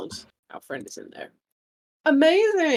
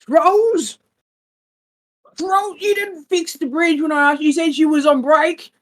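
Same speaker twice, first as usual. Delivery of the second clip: higher, irritated voice from the ziggurat; grumpy voice from inside; 9 semitones higher, 4 dB louder than usual. Acted. Hidden villain scolding the trolls through the door, higher and irritated. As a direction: grumble at the troll for shirking. Game master voices angry NPC.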